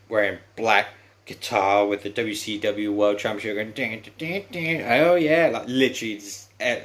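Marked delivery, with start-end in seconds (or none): 3.7-4.8 high sung dings like a ring bell; mimicked bell dings. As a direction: imitate the bell, rising higher. Imitating entrance bell sound.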